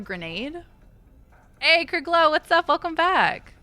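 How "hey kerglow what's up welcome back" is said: excited greeting. Real, not acted. Greeting a returning viewer.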